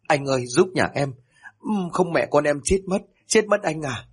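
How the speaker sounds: high, tearful plea for help; tearful pleading. A narrator's reading. Mother begs the militia chief, tearful. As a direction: beg through tears. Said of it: "mếu máo nói trong nước mắt".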